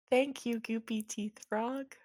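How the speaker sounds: soft, measured thanks; polite wife. Acted. Wife thanks the frog, softly polite. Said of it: "She goes".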